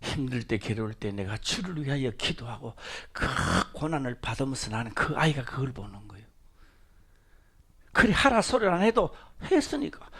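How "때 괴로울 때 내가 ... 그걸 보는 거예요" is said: unvoiced, hushed whisper. Real, not acted. Preacher tells the congregation about his child, whispered. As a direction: hush it for emphasis.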